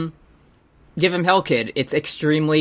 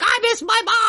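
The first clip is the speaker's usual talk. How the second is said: much higher, cried-out mock wail; hammy wail. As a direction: overdo it like a stage actor. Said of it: "and he's like".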